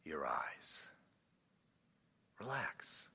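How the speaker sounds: low, very soft relaxation cue; guided meditation. Shown as a demonstration. Preacher easing the congregation into prayer, hushed meditative voice. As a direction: ease them down gently and quietly.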